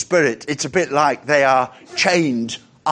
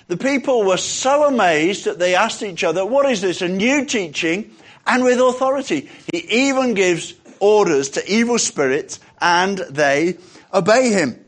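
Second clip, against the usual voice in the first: loud, raised public reading; emphatic scripture reading; 6 semitones higher, 5 dB louder than usual. A narrator's reading. Preacher reading the verse to the congregation, emphatically. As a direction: read it out boldly to a congregation. Reads scripture aloud with force.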